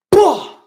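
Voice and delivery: a sudden, high, loud imitation of a dog's bark; imitated dog bark. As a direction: bark it out like the dog leaping the gate. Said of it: "All I hear was".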